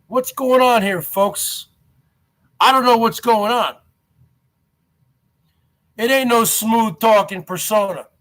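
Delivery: ordinary delivery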